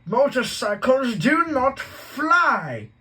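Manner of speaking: very loud, high, slow bellow; furious yell. Acted. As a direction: roar it into his face. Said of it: "yelled at Harry".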